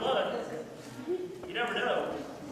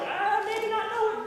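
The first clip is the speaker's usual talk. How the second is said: put-on unsure kid voice; 9 semitones higher than usual. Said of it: "that look in your eye like".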